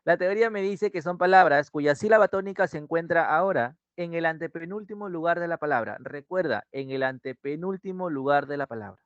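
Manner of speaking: ordinary talk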